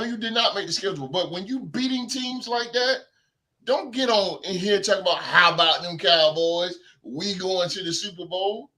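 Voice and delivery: ordinary talk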